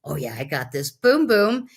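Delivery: punchy boom boom then a hushed aside; mock victory boast. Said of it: "I was like".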